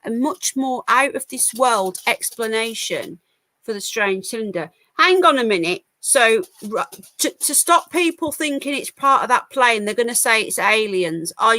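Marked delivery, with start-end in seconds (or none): 5.0-5.8 loud, abrupt objection; indignant interjection. Real, not acted. Streamer breaking off a news article to object, indignant. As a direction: cut in on your own reading, louder.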